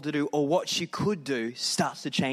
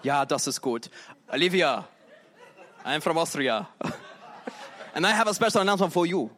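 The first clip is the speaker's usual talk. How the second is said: a hint of an Austrian accent, laughing through it; mock Austrian accent. Austrian visitor hamming an accent announcing to Olivia. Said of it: "an Austrian accent".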